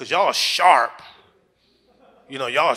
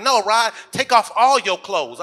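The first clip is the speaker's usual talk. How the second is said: higher, louder, slow demand; mock girlfriend, louder and higher; woman's insistent voice; 6 semitones higher, 6 dB louder, slower than usual.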